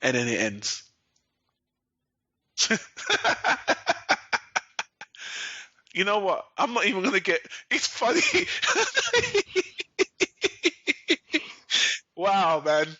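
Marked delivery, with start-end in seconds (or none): 2.6-5.6 high, breathless laugh; incredulous laugh. Real, not acted. Fan laughing at the rushed finale, high disbelieving laugh. 8.2-12.1 high, drawn-out laugh; long incredulous laugh. Real, not acted. Fan cracking up at the ending, high helpless laugh.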